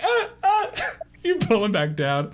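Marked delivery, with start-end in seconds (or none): 0.0-0.9 high, loud falsetto cries; piercing mock screams; screaming. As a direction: shriek in acted comic agony. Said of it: "Moo is just screaming like".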